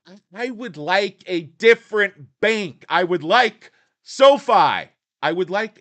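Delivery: loud, high demand for a new bank; shout. Acted. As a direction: yell the wish at the teller.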